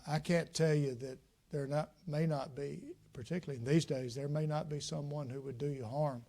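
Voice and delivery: ordinary talk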